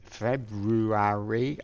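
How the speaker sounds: slow, questioning American "February" with a full "ary" ending; drawn out "February?" in a mock American accent. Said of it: "had an American accent".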